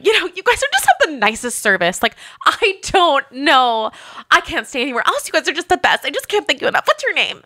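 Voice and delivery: high, gushing imitation of a sudden fan; gushing guest. Mimicking the guest switching to gushing praise, high and sweet. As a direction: ham up overflowing praise. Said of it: "just starts going".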